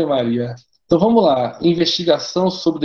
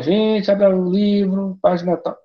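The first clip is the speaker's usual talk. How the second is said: mock bored teacher; 5 semitones higher, slower than usual. Mocking a lazy teacher again.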